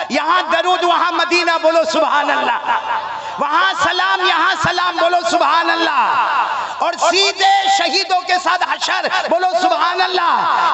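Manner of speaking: preacher leading a call and response; full-force preaching. Real, not acted. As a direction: rouse the crowd with each call.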